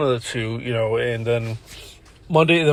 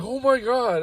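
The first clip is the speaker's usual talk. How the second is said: higher-pitched stunned exclamation; retold astonishment; 9 semitones higher, slower than usual. Coworker watching worker quit and leave, imitated astonishment. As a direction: imitate a taken-aback coworker.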